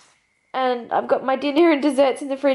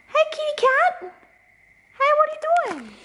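high, cooing cat greeting; doting, squeaky-high hello; pet-talk voice; 13 semitones higher than usual. Real, not acted. Talking to her cat.

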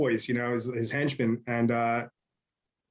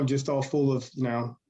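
normal talking voice

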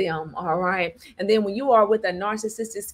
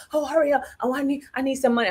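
higher, very fast fake panic; mock a fake crisis call; fake emergency; 5 semitones higher, much faster than usual. Narcissist asking for money, higher fast mock panic. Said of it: "they'll call you with fake emergencies".

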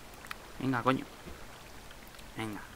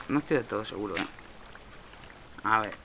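ordinary talk, nothing marked

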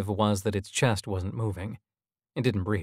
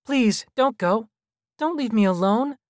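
put-on pleading child; 13 semitones higher, 5 dB louder than usual. A narrator's reading. Lonely child hologram begging visitors to stay, high and pleading. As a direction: beg them in a high child's voice.

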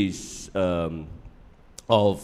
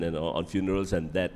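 normal talking voice

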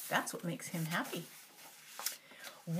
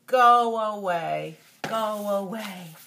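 loud, slow grouch yelling; grouchy yell; 10 dB louder, much slower than usual. Acted. Oscar yelling from his can at everyone, loud and slow. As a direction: drive them off with a bellow.

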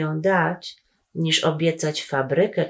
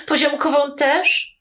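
put-on high, eager little-kid question; small brother's hopeful high question; eager little boy; 8 semitones higher than usual. A narrator's reading. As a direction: ask like a small boy hoping for a treat. Little Kuba asks eagerly.

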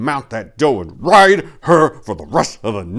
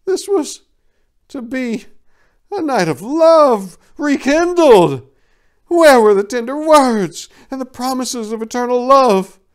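heartbroken lament; 14 semitones higher, 5 dB louder than usual. A narrator's reading. Narrator voicing Vixen's grief in the snow, high and aching. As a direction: ask it like a wounded lover.

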